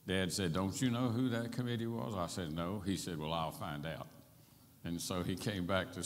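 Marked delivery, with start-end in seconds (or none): none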